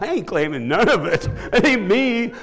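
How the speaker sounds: laughing while waving off the credit. Real, not acted. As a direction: refuse the credit through real laughter.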